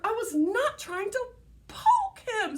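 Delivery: put-on a kid denying it hotly; indignant little sister. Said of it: "said Sister".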